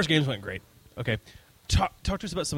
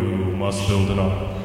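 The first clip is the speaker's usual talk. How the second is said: low, slow divine command from above; God voice; 5 semitones lower, slower than usual. Pretend. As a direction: command Noah from the heavens. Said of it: "God voice".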